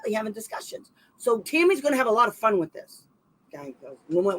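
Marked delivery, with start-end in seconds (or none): 0.0-0.9 a dry, softer mock "really", fading to a whisper; sarcastic aside. Reading the text messages, muttering sarcastically under her breath. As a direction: whisper the jab as if to yourself. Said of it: "and I'm like".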